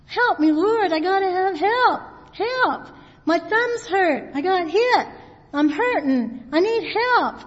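high, urgent plea; crying out for help. Acted. Preacher acting David begging the Lord, crying out for help. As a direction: cry out like someone hurt. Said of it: "And he's crying out".

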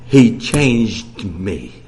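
very slow, low, loud; the sister's awed testimony quoted slowly. Retold or imitated. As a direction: draw out He changed me with awe. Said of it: "She said".

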